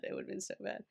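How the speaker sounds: a hushed echo of the line before; whisper. Real, not acted. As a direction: drop to a whisper on the repeat. Repeats the same phrase, then whispers.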